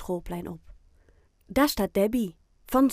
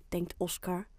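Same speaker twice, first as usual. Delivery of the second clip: plain reading voice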